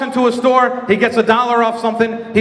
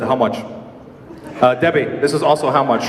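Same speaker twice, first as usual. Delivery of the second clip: low dad voice; 9 semitones lower than usual. Retold or imitated.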